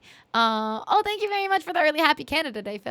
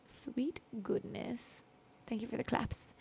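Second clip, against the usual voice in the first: a slow, breathless whisper, much softer; 12 dB quieter, slower than usual. Real, not acted. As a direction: barely breathe the words out, touched. Overwhelmed by chat's kind words.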